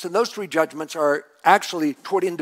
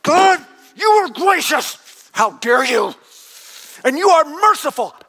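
shouted, higher, louder angry prayer; furious prayer; 8 semitones higher, 5 dB louder than usual. Acted. Jonah raging at God for his mercy, shouting. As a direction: spit the praise out like an accusation. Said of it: "He's furious at God".

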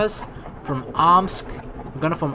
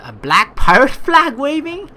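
"pirate flag" higher and louder through a laugh; laughing disbelief; 10 semitones higher, 7 dB louder than usual. Real, not acted. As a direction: laugh out the words in surprise.

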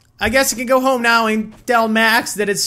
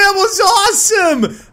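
thrilled shout; 10 semitones higher, much slower than usual. Real, not acted. Thrilled by big jump attack.

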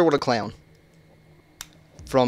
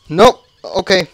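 startled; 6 semitones higher, 9 dB louder than usual. Real, not acted. Sudden scare.

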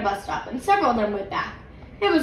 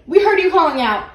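excited; 6 semitones higher, 7 dB louder than usual. A narrator's reading. Judy still excited.

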